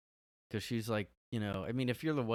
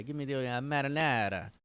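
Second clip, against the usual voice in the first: higher, hammed-up mobster Italian on "marinara"; a light Italian-American wiseguy order; playful fake Italian "marinara", a touch louder; mock Italian accent; 5 semitones higher, 5 dB louder than usual. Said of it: "going like".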